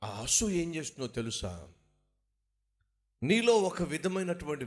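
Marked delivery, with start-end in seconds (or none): none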